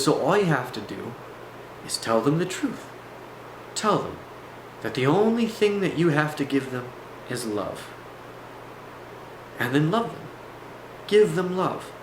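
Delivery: lightly voiced guru, spaced out in short phrases; guru talk. Guru advising his visitor about demons, lightly imitated guru talk. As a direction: teach it with long pauses, a hint of guru. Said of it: "what I call the guru talk".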